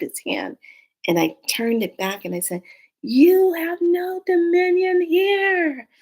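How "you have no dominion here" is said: high, slow, commanding voice; commanding banishment. Retold or imitated. Banishing the dream creature, slow high command. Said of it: "I was screaming at it".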